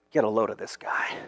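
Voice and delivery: soft, low sneering whisper; whispered mockery. Preacher voicing scribes whispering mockery behind Jesus' back.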